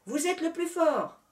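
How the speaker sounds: high, loud rabbit cheer; shouted encouragement. Acted. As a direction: egg them on with a shout. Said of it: "cria-t-il".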